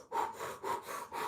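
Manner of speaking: soft puffing breaths of a runner; imitated panting. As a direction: mime being out of breath. Imitating breathing while running.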